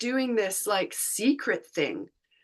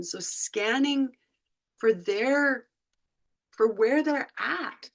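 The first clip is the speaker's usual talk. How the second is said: ordinary delivery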